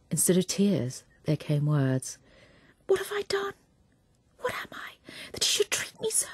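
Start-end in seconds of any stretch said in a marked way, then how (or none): 2.9-6.3 high, breathless whispered despair; anguished whisper. A narrator's reading. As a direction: whisper it to herself as if hurt. Dorothea's outburst alone in boudoir.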